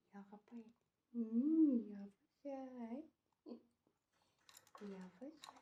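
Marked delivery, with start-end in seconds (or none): none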